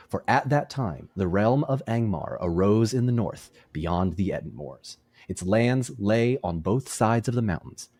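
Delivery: measured storytelling read; story narration. Acted. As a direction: read it like an epic chronicle. Reads history passage aloud.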